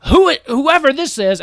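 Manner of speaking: high, loud, sputtering outrage; furious. Acted. King rants about his daughter's unknown husband, furious. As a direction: rage and stumble over the words.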